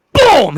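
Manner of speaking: loud high "boom!" impact shout; big shouted impact sound. Acted. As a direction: slam the boom out like a blow. DM narrating villain's strike.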